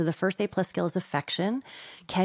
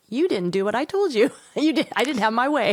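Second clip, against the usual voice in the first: higher, louder whiny tantrum; a hammed-up child's complaint, pitched up and loud; mock angry kid; 6 semitones higher, 4 dB louder than usual. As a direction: act out a spoiled child's indignant outburst. Acting out an angry entitled kid.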